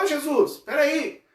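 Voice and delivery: high-pitched mock yell after someone leaving; hungry disciple's hammed-up holler; acted calling out. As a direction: call out in protest, pitched well up. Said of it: "Ele gritou lá".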